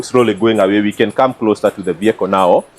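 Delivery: ordinary talk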